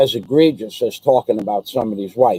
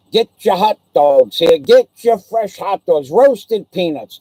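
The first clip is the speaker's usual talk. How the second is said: ballpark vendor hawking hot dogs, higher and louder; street vendor calling; 5 semitones higher, 4 dB louder than usual. Retold or imitated. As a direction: call out like a stadium hot dog vendor. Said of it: "that kind of crap".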